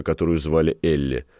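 ordinary delivery, nothing marked

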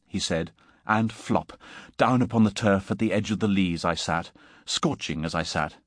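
plain reading voice

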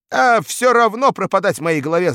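dashing bark. A narrator's reading. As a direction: bark it out with daring. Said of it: "залихватски рявкнул он".

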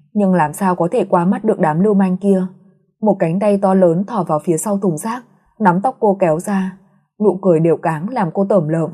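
plain reading voice

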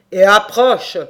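very loud devil's summons; booming, peremptory command; barked order. A narrator's reading. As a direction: order him over forcefully. Devil orders the captive closer.

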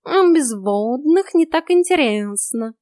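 slow, loud, cheeky snort of a cat; the cat snorts back smugly. A narrator's reading. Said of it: "фыркнул кот".

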